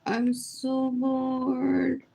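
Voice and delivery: slow, stretched out, bored; slow bored drawl. Shown as a demonstration. Telling the viewers she's bored, said slowly. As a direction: let each word sag slowly. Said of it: "says it twice".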